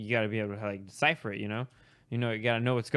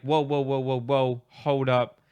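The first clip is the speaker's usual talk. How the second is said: louder run of whoas cutting in; halting interruption; 5 dB louder, slower than usual. Retold or imitated. Caller stops the student celebrating graduation, halting. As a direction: stop someone mid-celebration.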